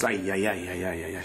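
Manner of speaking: low, soft anguished moaning; dismayed groan.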